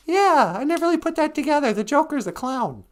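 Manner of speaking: very high, quick squeaky reply; put-on falsetto. Storyteller playing someone just catching on, put-on falsetto. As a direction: answer yourself in a silly falsetto. Answers own question in put-on voice.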